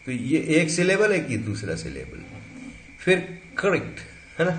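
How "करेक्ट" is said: "करेक्ट" with the weak vowel kept after k; full-form pronunciation. Shown as a demonstration. Teacher showing the word before reduction, full pronunciation. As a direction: say "करेक्ट" as two syllables, "kuh-rekt". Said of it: "stress दिया है".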